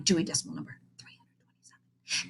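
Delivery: fast whisper; whispered aside. A narrator's reading. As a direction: rattle it off in a whisper. Library call number aside.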